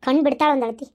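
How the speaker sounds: normal talking voice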